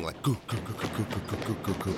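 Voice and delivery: soft rapid goo noises for running feet; mimicked footsteps. Retelling the ghost night, soft imitated running footsteps. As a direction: patter out the footsteps quietly. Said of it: "people running".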